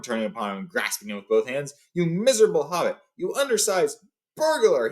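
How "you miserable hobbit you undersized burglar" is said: shouted. Acted. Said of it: "he shouted, at a loss for words".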